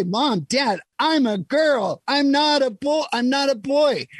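put-on high, slow insistent child voice, pressing on "not"; child insisting. Son telling his parents he is a girl, high slow child voice. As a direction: play the kid telling mom and dad who they are. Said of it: "And they say".

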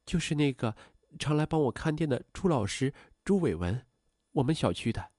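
soft, shy mother's admission; bashful. A narrator's reading. Mother shyly tells daughter who the man is, bashful. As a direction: admit it quietly and coyly. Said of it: "羞赧地低下头说".

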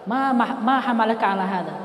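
softly, quietly asking, almost tenderly; a gentle leader asking softly why; tender question. Pretend. The Prophet softly asks the soldier hugging him, tenderly quiet.

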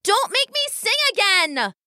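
high-pitched, petulant little weasel threat; stomping tantrum. A narrator's reading. Weasel sister warning her family, in a stompy tantrum. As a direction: stamp it out high and indignant. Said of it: "She stomped her little weasel foot".

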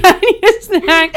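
put-on high, loud, drawn-out kid demand; loud bratty kid voice; whiny little kid. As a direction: demand it like a child pestering Mom. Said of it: "a little kid voice in your head".

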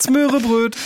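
Smörebröt squeaked in mock Swedish. Mocking Swedish words.

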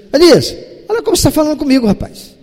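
mock rebuke. An imagined stern God scolding Elias, quick and sharp. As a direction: pull rank on him in a hurry. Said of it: "Deus não chega pra Elias assim".

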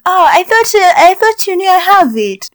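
high innocent surprise; imitating friend's innocent "oh"; mock surprised friend. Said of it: "And they're like".

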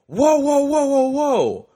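loud, high, drawn-out mock alarm; startled yell. Man shaken at midnight by birthday wish, startled yell. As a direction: yelp it in exaggerated alarm.

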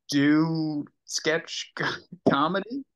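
normal talking voice

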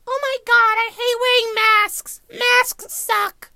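very high, slow mocking whine; high nasal-free mocking complainer voice; whiny complainers. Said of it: "people complaining, like".

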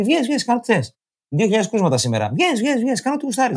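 high, sing-song mocking of the authorities; high mock official. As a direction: parody a careless official urging everyone out. Mocking government telling people go out.

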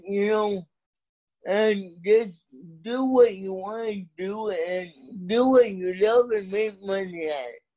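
ordinary talk, nothing marked

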